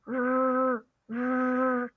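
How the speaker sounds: "r" held slowly with light buzzing; Mandarin r. Shown as a demonstration. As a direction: curl the tongue and buzz a long "r". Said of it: "and say r".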